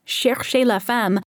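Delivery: ordinary talk, nothing marked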